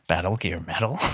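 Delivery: hushed gruff codec whisper; Snake impression. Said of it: "I can do an okay Snake impression".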